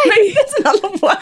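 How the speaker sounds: high, helpless laughter; cracking up at herself; laughing. Real, not acted. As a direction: repeat it giggling. Laughing repeat.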